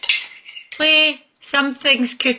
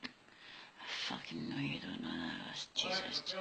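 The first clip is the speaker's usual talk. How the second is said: exasperated mutter; 24 dB quieter than usual. Real, not acted. Muttering at Alexa.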